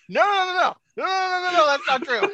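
high, frantic no, no, no; a flustered dad scrambling to deny it; panicked denial. Pretend. Father denying affair.

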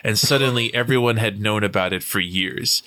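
ordinary delivery

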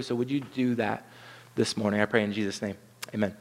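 prayer. Acted. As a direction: pray it humbly and unhurried. Pastor prays before sermon.

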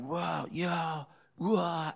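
high, breathy imitated "whoa, yeah, whoa"; mock overwhelmed gasp. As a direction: imitate the girl overwhelmed by the power. Girl overcome as power hits.

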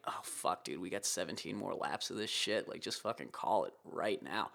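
quiet mock resignation; resigned grumble. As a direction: mutter it like a rider giving up. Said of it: "you're kinda like".

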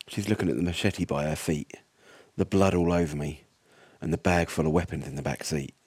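tense first-person horror read; steady, low-key storyteller voice; story narration. A narrator's reading. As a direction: narrate it like a survivor's diary.

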